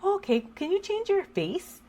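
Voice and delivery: put-on a child politely asking for something; daughter's voice. Daughter asks mom to change her face, in a high child voice. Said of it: "And she's like".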